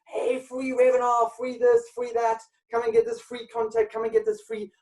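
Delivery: high-pitched hawker's mock shout; mock promotional shouting. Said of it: "They were all shouting like that".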